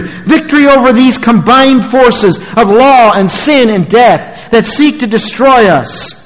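high, ringing pulpit proclamation; triumphant preaching. Real, not acted. Preacher proclaims victory over death to congregation, triumphant preaching. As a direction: proclaim it high, stretching the phrase endings.

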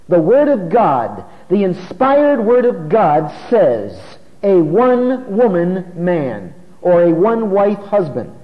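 slow, weighty emphasis on each word; emphatic proclamation. Real, not acted. Preacher insisting to congregation on scripture's exact wording, emphatic. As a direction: drive every word home slowly and firmly.

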